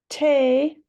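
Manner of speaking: "te" said high and loud with a long A; long A syllable. Shown as a demonstration. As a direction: stress the syllable te. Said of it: "the A says its own name".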